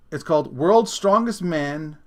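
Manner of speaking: ordinary talk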